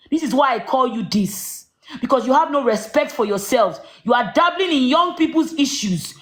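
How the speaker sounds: ordinary talk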